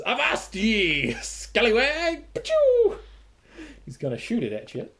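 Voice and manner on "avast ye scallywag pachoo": high, slow pirate bellow; old sailor. Acted. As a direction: ham up a salty pirate captain. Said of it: "and he says".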